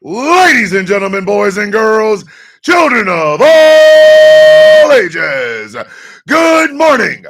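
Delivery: big booming show-opening announcer, stretched words; announcer intro. Acted. Host opening the morning show to viewers, booming announcer. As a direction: boom it out like a ringmaster.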